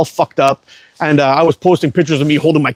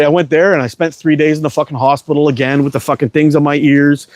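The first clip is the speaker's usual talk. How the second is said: ordinary talk, nothing marked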